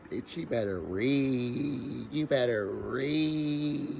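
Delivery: drawn-out sassy "you better read"; sassy slow taunt. Pretend. Taunting the lady on the show, slow and sassy. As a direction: stretch each word, taunting her to read. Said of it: "hold on".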